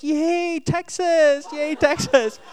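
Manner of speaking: put-on high-pitched little-kid cheer; gleeful, high, repeated cheer. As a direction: mimic a small child cheering for his team. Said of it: "he saw us cheering".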